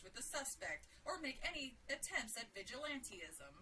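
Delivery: urgent TV bulletin delivery; punchy, emphatic newscaster voice; news reporter. Acted. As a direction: read it out like an emergency broadcast.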